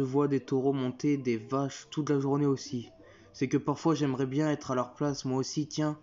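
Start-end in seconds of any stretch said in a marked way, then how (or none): none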